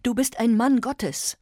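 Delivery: loud, high imploring; pleading. Acted. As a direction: beg him as a man of God. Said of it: "flehte Modesta Bruder Timotheus an".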